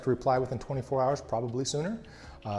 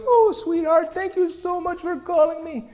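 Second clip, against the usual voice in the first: much higher, sweet grandmotherly voice; sweet old lady; 8 dB louder than usual. Retold or imitated. Grateful old lady thanks the caller, high and sweet. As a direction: gush thanks like a sweet granny. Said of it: "she was a very sweet woman".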